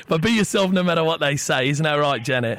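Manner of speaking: chatty fake English caller; poor English accent. Prank caller slips song lyrics to cafe, poor English accent. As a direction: ramble on in a badly faked English accent. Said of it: "disregard the piss poor English accent".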